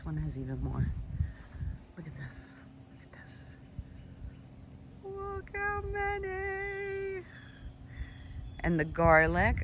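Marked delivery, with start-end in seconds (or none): 2.0-3.6 hushed whisper, close in. Real, not acted. Gardener leaning close to show viewers a plant, whisper. 5.0-7.2 high, slow, drawn-out wonder; marvelling. Real, not acted. Gardener showing viewers all the buds, high slow wonder.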